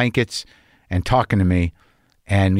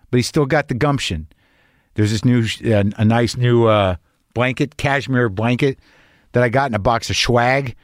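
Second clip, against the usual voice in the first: usual speaking voice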